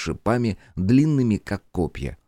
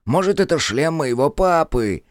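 whining, high and loud; whiny kid; 8 semitones higher, 4 dB louder than usual. A narrator's reading. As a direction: beg like a whiny child. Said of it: "ныл он".

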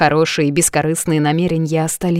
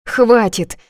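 tearful outburst; 6 semitones higher, 5 dB louder than usual. A narrator's reading. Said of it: "отвечаю со слезами".

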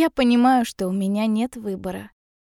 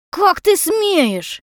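high, loud, drawn-out fury; outraged shout; 9 semitones higher, 5 dB louder, much slower than usual. A narrator's reading. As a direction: stretch it out in outrage. Mother outraged, about to hit.

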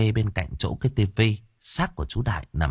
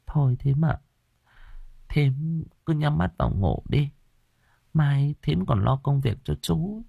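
gentle coaxing; 8 semitones higher than usual. Acted. Elder sister-in-law coaxes the widow to rest, gently higher. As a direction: soothe her like a worried elder sister.

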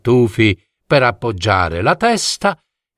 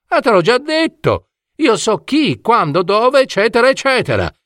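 high, quick dormouse rattling it off; high little dormouse, smug and hurried; know-it-all dormouse; 9 semitones higher, faster than usual. Acted. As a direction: rattle off eccetera like it's obvious. Dormouse brags about knowing all.

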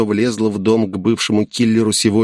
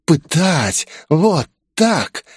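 high-pitched, astonished questioning; surprised whisper; 7 semitones higher, much slower than usual. A narrator's reading. Grace taken aback by the torture threat, surprised. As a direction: ask in surprise, voice jumping up. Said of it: "удивлённо прошептала Грейс".

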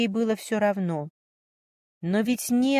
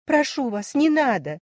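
pleading; 7 semitones higher, 3 dB louder than usual. A narrator's reading. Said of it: "взмолилась Варя".

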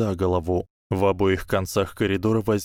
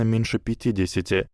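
ordinary delivery, nothing marked

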